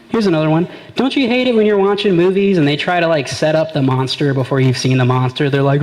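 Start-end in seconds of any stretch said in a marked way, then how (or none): none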